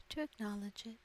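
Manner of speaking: ordinary delivery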